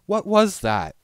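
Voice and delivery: high loud frightened question; shaken. A narrator's reading. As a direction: still scared, demanding. Rarity shaken after time stops.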